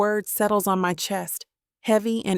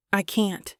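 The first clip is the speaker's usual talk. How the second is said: usual speaking voice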